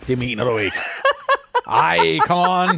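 laughs through the protest, slowing down; real laughter at a silly request; laughing disbelief. Real, not acted. Cohost laughing as she's handed a pencil exercise.

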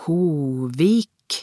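"Hovik" said the Norwegian way, long o and v; Norwegian pronunciation. A narrator's reading. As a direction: sound out "Hovik" as a Norwegian name, rising at the end. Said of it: "sa Kjell med norsk uttale". («Hovik» med norsk uttale)

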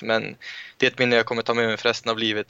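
ordinary talk

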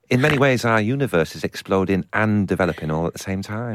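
usual speaking voice

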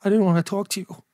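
lightly imitated mother, reluctant to confess; a hint of mum's put-on voice; retold mother's voice. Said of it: "She was like".

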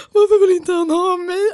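high, loud whiny crying; wailing in self-pity in bed; mock sobbing. (gråtande, gnällig klagan)

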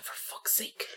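muttered whisper under the breath. Retold or imitated. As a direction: whisper it in exasperation. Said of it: "I was like".

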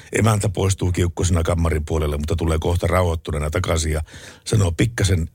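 ordinary talk

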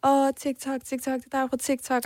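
mock excited call of recognition; imitated boys' calling. Teenage boys spotting a TikToker in the street, imitated excited calling. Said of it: "Så begynder de sådan at råbe".

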